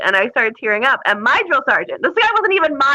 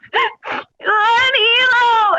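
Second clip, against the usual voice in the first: drawn-out, higher sobbing wail; mock bawling; 11 semitones higher, much slower than usual. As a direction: imitate a recruit crying mid-shout. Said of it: "she's in a full bawling episode".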